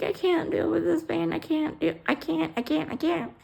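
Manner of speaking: high, strained cries of pain; pained pleading. Retold or imitated. To staff while retching, pained high pleading.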